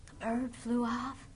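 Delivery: plain reading voice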